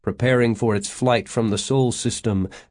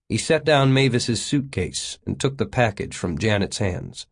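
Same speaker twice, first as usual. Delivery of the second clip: ordinary delivery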